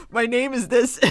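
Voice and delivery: words broken up by laughter, slowed; laughing. Retold or imitated. Caller laughing as she retells giving her name. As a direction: laugh through the line.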